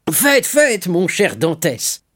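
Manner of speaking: high, loud start, slowing on the name; hearty consent. Acted. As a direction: grant leave with exuberant goodwill. Shipowner readily gives leave, calling out. (accord donné avec entrain)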